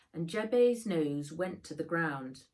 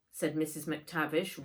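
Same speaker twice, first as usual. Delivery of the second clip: usual speaking voice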